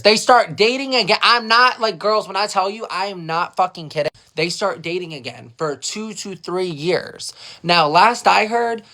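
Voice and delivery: usual speaking voice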